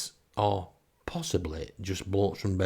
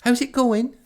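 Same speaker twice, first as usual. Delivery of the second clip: high, loud voice of a woman caller; Tracy; 7 dB louder than usual. Acted. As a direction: chirp it brightly down the phone. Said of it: "Tracy phones me".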